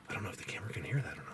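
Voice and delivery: quick, whispered aside to camera; hushed aside. Real, not acted. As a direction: whisper it fast while listening out. Listening to whistles, talks under breath.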